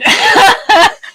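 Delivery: bright burst of laughter; high laugh. Real, not acted. Laughs after money joke.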